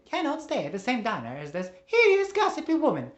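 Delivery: very high, loud caricature; Missus Wiggles, indignant. Acted. Missus Wiggles denounces another diner, indignant squawk. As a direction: squawk the insult in outrage.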